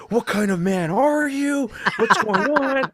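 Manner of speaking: high, loud imitated yell, slow on the first line; mock scream. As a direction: imitate the girlfriend screaming at him over the roach. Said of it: "she's fucking screaming at me like".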